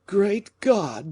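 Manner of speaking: high startled exclamation; alarmed gasp. A narrator's reading. Fouquet dismayed by the king's order, high startled cry.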